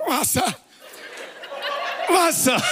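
put-on toddler calling. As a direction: squeal it like a thirsty baby. Toddler in car seat calling.

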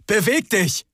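high urgent command; urgent call. Acted. Rick urges frozen Hubert to move, high call. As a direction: snap him out of it. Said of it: "rief Rick".

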